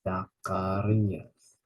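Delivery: "Zacharias" said very slowly, za-ka-RI-as; slow model. Shown as a demonstration. Teacher slowly modelling "Zacharias" in Greek. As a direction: draw out "Zacharias". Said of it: "slow model pronunciation".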